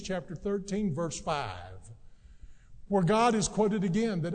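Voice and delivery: usual speaking voice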